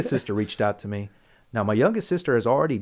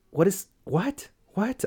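high-pitched, baffled questioning; incredulous; 10 semitones higher, slower than usual. Retold or imitated. Son feeling nobody gets his message, incredulous. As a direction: voice leaps up in mock disbelief. Acts out baffled disbelief at relatives.

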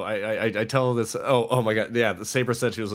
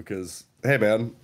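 ordinary talk, nothing marked

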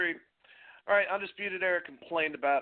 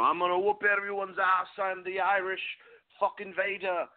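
put-on Irish brogue, pitched higher; terrible Irish accent; 7 semitones higher than usual. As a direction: ham up a bad Irish accent, playing a brash wrestler. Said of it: "terrible Irish accent".

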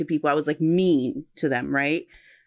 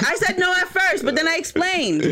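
high-pitched defensive laugh-talk; laughing protest; 11 semitones higher than usual. Real, not acted. Speaker defends earlier answer to the others, laughing protest. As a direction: protest through laughter, voice shooting up.

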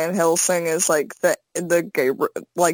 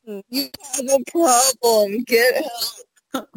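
high laughing retort; 5 semitones higher than usual. Real, not acted. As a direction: tease through a giggle. Teasing co-host about shirt.

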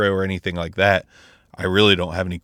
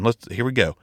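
ordinary talk

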